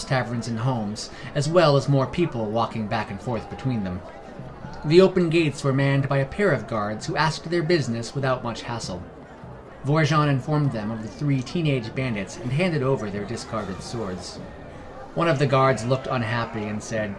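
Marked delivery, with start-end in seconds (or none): none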